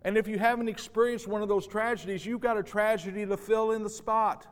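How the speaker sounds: loud, high preaching build; preaching at full force. Real, not acted. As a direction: preach it out to the whole room.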